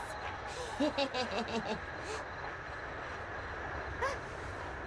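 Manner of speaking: put-on soft breathy childish giggle; playful ghostly laughter; mischievous giggle. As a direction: giggle as you run off to hide. Ghost children start chase game.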